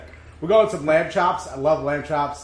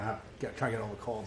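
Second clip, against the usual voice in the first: hoarse and quiet between coughs; croaky voice after coughing; coughing fit; 7 semitones lower, 16 dB quieter, faster than usual. Real, not acted. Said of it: "Excuse me, I keep coughing".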